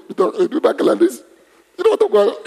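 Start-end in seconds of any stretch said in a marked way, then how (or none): 1.8-2.3 very high, about to burst out laughing; words giving way to giggles; breaking up. Real, not acted. Preacher imitating phone users, breaking up.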